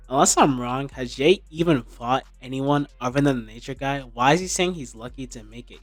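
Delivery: usual speaking voice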